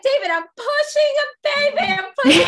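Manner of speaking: high, loud strained yell; screaming. Retold or imitated. Woman in labor screaming at her husband, high and loud. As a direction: shout back mid-push. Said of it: "screaming at each other".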